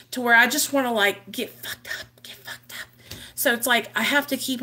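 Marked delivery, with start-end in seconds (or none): 1.3-2.9 whispered, almost breathless craving; hushed craving. Retold or imitated. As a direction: breathe it out like a secret urge. Craving voiced in a hush.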